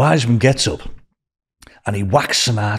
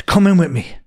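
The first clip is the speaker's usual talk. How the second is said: pitched-up coaxing invitation; gentle request; 8 semitones higher, 3 dB louder than usual. Retold or imitated. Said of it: "he said to me".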